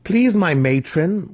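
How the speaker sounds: high, slow whimpering plea. A narrator's reading. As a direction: beg her, whimpering. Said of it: "Alton whimpered".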